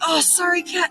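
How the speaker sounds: high-pitched "oops" apology; hurried mock apology. Storyteller reliving smacking the cat, high apologetic yelp. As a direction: toss out a quick, high apology.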